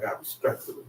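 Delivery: God's words, almost whispered; hushed God voice. Acted. Preacher voices God's quiet respect for Israel. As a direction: breathe it softly as God.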